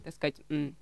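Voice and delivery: ordinary delivery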